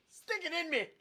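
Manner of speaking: very high, loud squealed plea; over-the-top shrill joke voice; mock eager squeal. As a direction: squeal it in a put-on voice. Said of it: "Yet another thing I never wanted to hear you say".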